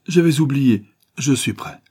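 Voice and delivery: hasty apology. A narrator's reading. Barbicane remembers his duel with Nicole, urgent declaration. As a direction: jump up ready for the duel.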